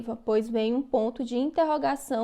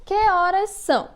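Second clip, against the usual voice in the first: higher and louder question tune on "Que horas são?"; asking question demo; 6 semitones higher, 4 dB louder than usual. Shown as a demonstration. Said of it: "Na frase".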